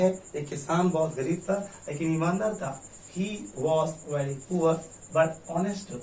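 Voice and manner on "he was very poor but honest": very slow, one even line; slow model answer. Shown as a demonstration. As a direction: read the answer slowly. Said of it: "answer देंगे". (धीमा उत्तर वाचन)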